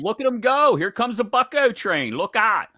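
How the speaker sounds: put-on hyped-up high, loud Pittsburgh fan accent. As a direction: cheer the Pirates in a thick Pittsburgh accent. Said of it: "That is a great accent".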